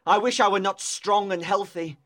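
impassioned accusing lover; tormented outpouring, stressing the key words; anguished cry. A narrator's reading. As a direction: hurl the reproach with heavy emphasis. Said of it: "he cried".

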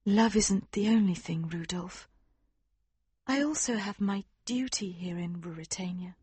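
high, soft and gentle, fading to a whisper; gentle princess. Acted. Princess gently refuses him, high and soft. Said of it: "she said gently".

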